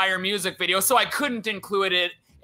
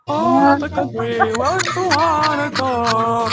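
mock impression; 4 semitones higher, 3 dB louder than usual. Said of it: "he reminds me of".

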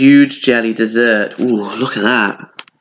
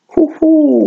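high gleeful hoot; delighted hoo-hoo; 4 dB louder than usual. Real, not acted. Teacher eyeing the party food: high gleeful hoot. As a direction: coo over the tart.

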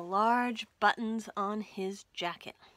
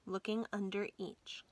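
usual speaking voice

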